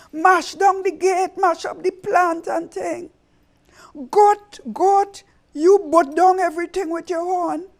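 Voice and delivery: high, loud rallying cry; Anansi rallying. Acted. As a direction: rouse the animals to fight.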